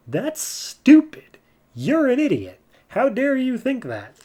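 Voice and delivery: high, slow mock-angry jeer at a foolish plan; scornful outburst. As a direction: sneer it out like a furious goddess. Soraka scorning Wonder Woman's plan.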